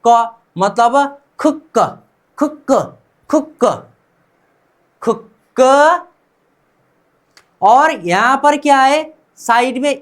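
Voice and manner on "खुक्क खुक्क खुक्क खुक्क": खुक्क said as "khuk-ka" with a doubled k; pronunciation demo. Shown as a demonstration. Teacher drills a new word for learners, pronunciation demo. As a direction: sound out खुक्क in two beats, khuk-ka. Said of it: "equal to बनेगा क्या?".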